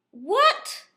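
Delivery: high, loud what; startled on the phone; surprised what. Retold or imitated. Tour guide surprised by the call. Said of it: "he's like".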